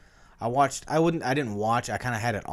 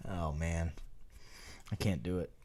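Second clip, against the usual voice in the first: ordinary delivery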